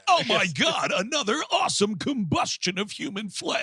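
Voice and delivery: high, gushing race commentary; hammed-up awe at a fiery wreck; mock excited announcer. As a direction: play the thrilled commentator. Joins the mock announcer bit.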